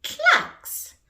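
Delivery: high, loud clucky word; chicken cluck. Acted. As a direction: say it like a clucking hen. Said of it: "A chicken clucks".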